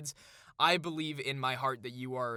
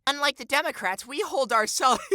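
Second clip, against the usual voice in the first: high, loud put-on writer voice; mock the writer in a raised, exaggerated voice; high-pitched parody read-aloud; mocking article voice; 9 semitones higher, 7 dB louder than usual. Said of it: "I keep doing the voice".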